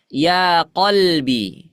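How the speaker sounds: slow "Ya qalbi", q from the back of the throat; Arabic qaf. Shown as a demonstration. Teacher shows students the qaf in "qalbi", slow and careful. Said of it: "menggunakan huruf qaf atau kaf?".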